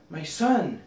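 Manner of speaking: joyful call. A narrator's reading. King spotting his lost son, calling out.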